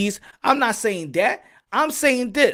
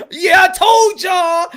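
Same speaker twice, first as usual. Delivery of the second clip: higher, louder, slow mock gloat; gloating shout; 9 semitones higher, 8 dB louder, much slower than usual. Fan mockingly crowing after the upset, loud and slow. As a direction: crow it out like a smug fan. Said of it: "be like".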